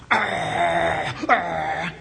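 imitated little dog growling. Preacher imitating his dog guarding her chew bone, growling low. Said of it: "she's going".

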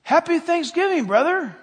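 put-on high, loud child's greeting; imitated little granddaughter. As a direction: holler it back like a small kid playing grown-up. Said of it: "And she said to him".

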